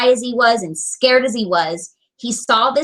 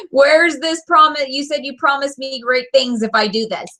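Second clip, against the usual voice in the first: lightly voiced skeptical viewer, higher; mock viewer voice; 4 semitones higher than usual. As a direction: tease the doubting audience, half laughing. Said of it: "you guys are probably thinking".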